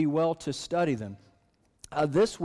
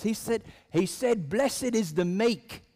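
put-on higher voice in a mild British accent, slow on the verse; 6 semitones higher than usual. Man in front passing Jesus' words back, in a light British accent. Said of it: "the guy in front of her says".

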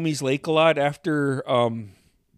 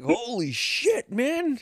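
higher and slow, stunned "holy shit"; shocked; 9 semitones higher, slower than usual. Retold or imitated. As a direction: react like you just saw someone knocked out. Shocked at the knockout, retold.

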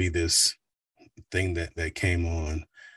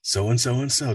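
smooth announcer; 7 semitones higher than usual. Shown as a demonstration. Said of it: "just very smooth".

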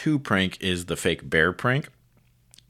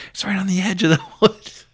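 much higher and louder, breaking into a laugh; excited answer; 6 dB louder than usual. Retold or imitated. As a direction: blurt it out, barely holding the laugh. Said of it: "He's like".

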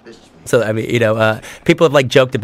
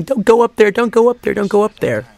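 pleading to the screen; 9 semitones higher than usual. Retold or imitated. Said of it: "we want to internally be saying".

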